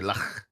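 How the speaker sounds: "lach" with a throaty Scouse ch at the end; demoing Scouse "lach"; Liverpool pronunciation. Shown as a demonstration. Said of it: "they'll say".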